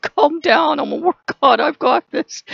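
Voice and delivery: mock war god. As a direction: brush her off like an amused war god. War god laughing her off.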